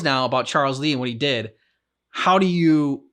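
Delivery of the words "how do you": normal talking voice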